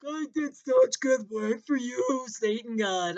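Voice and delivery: slow put-on naive voice; mocking quote. Comic mocking a loyal worker, slow put-on voice. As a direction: play the loyal fool, drawn out. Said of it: "and you're like".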